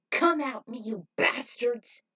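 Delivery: loud, slow challenge to the mob; defiant taunt. A narrator's reading. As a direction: goad the crowd into a fight. Facing the mob.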